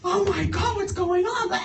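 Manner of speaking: high, shocked cry; alarmed cry. Retold or imitated. Friends crying out as he is cuffed, alarmed. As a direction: gasp it out in alarm.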